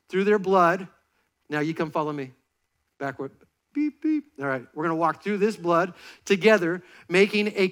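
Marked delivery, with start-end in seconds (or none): 3.7-4.2 high playful beeping, like a truck backing up; reversing truck beep. Pretend. Walking backward like a truck.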